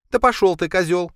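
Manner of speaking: high, loud snarl; snapping back. A narrator's reading. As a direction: bite back like an angry woman. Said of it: "огрызнулась в ответ".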